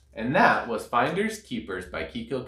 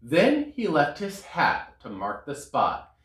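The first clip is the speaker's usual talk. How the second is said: ordinary delivery, nothing marked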